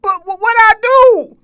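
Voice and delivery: put-on high, loud kid's protest; mock whiny kid. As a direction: whine back like a badass kid. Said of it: "They be like".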